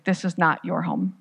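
ordinary delivery, nothing marked

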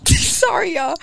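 apologising through a laugh, high-pitched; high, giggly apology. Real, not acted. Co-host apologising to listeners mid-intro, laughing. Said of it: "Sorry, we laughing".

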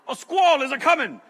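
lightly put-on folksy warning; a hint of a hammed-up sailor; funny old-timey voice. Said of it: "what is that voice?".

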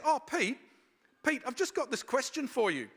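a hint of an eager young woman calling out; Nikki's voice. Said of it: "comes in and says".

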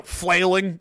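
ordinary delivery

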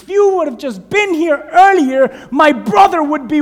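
high, loud accusing plea; blaming sister. Acted. Lazarus' sister blaming Jesus, loud and hurt. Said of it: "What does she begin to tell Jesus?".

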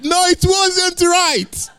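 drawn-out high-pitched no, comic; high slow mock denial. Preacher correcting his translator, in a high slow mock voice.

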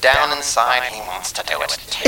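1950s narrator voice; lightly voiced old-time radio announcer; old-timey baseball announcer. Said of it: "Old-timey baseball announcer".

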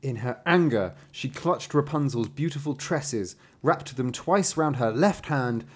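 heightened fairy-tale reading; dramatic story narration. A narrator's reading. Narrator telling of the braids being cut, dramatic storytelling.

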